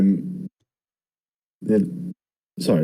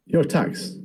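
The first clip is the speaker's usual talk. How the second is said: quoting the mentor; 6 semitones higher than usual. Retold or imitated. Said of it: "He's like".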